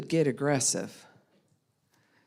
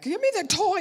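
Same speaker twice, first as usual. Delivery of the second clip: put-on high, loud little-kid grab; toddler demand; 6 dB louder than usual. Toddler demanding a toy from another kid, high and loud. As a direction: squeal it like a grabby two-year-old.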